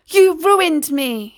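loud, high, drawn-out angry shout; shouted accusation. A narrator's reading. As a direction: cry it out slowly as a furious accusation. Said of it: "he shouted in his heavy Russian accent".

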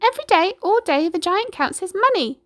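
high, loud clucking hen; clucking chicken. A narrator's reading. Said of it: "she clucked".

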